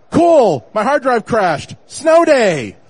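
excited. Retold or imitated. Delighted reaction to hard drive crash.

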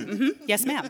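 laughing, amused yes ma'am; laughing agreement. Retold or imitated. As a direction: chuckle through it. Retold reaction to belting.

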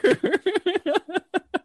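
squealing laugh; high cackle of laughter. Real, not acted.

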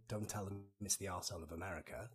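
hushed, low inner-voice aside; thinking to himself. Retold or imitated. Man warning himself beside two Minnesotans, hushed aside. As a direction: drop right down for a private thought. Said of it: "in my head thinking".

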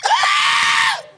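high loud mock scream; neighbor's loud scream; terrified scream. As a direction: scream at the dog. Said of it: "you even scream that".

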